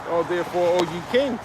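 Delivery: normal talking voice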